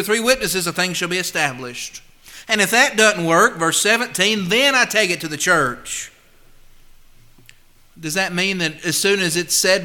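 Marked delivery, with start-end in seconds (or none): none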